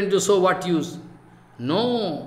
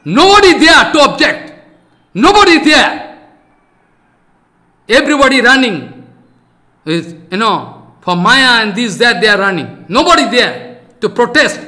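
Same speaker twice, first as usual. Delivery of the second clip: fiery preaching; 3 semitones higher, 7 dB louder than usual. Real, not acted. Preacher laments that nobody objects, loud and fiery. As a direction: blast it out to the assembly.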